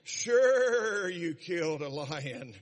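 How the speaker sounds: "sure" punched loud, then "you killed a lion" slow and mocking. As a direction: tease him openly, drawing it out. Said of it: "I'd say".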